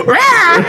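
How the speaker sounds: very high imitation squawk; parrot squawk. Friend mimicking the featherless parrot, squawking. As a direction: screech it out like a bird. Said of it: "He was like".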